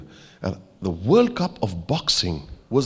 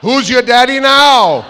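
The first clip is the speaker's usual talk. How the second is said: high, drawn-out triumphant holler; loud, stretched-out teasing call; playful shout; 14 semitones higher, 10 dB louder, slower than usual. Real, not acted. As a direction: shout it high and slow, crowing. Calls out playfully to audience.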